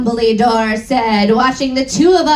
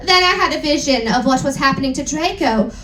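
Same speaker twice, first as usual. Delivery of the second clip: usual speaking voice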